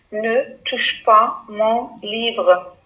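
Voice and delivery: "Ne touche pas mon livre" read slowly and evenly. Shown as a demonstration. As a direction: spell it out slowly for the class. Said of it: "Regardez bien le verbe".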